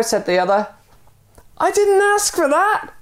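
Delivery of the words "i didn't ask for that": very high indignant mock yell; a high, protesting yell at the stranger; storytelling yell. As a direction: yell back in protest, pitch way up. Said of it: "yelled the man".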